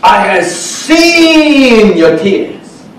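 SEEN stretched very long and loud, then falling; emphasized declaration. Acted. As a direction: proclaim it slowly, leaning hard on SEEN. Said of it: "I like to emphasize the part".

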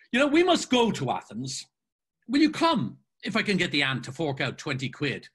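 animated, cajoling character voice; boisterous Mulligan. Acted. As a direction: start high and eager, then ease off.

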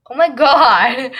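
loud, drawn out oh my God; loud exclamation. Real, not acted. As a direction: burst out at the blunder. Laughing at her own slip.

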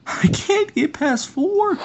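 shrieking in frustration; squealing it out high and slow; high frustrated wail. Real, not acted.

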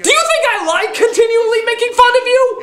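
high, shouting plea to a friend; half laughing, half crying at Dom; anguished high rant. Acted. Ranting at voice actor Dom.

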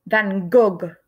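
Frenchified "Van Gogh", a short rounded "og"; French pronunciation. Shown as a demonstration. Teacher showing kids the French version, pronouncing it. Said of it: "In France, people called him".

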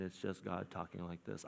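low, quiet, level monotone. Shown as a demonstration. Preacher mocking God as monotone, in a low drone. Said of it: "it's a monotone thing".

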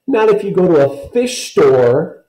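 put-on high, loud childish bellow; Kirsty mocking Ellen, high loud bellow. A narrator's reading. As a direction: bellow it back like a furious little girl. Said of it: "Kirsty bellowed".

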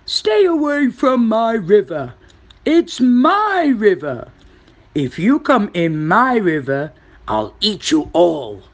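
loud, far-carrying crocodile roar at the opening; threatening shout. A narrator's reading. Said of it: "he shouted to the creatures".

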